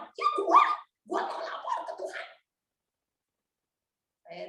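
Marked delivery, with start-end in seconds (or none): 0.1-2.3 high, defiant me-first; defiant angry claim. Retold or imitated. Wife claiming first turn to pray, defiant. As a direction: snap back that you go first. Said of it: "Lagi marah".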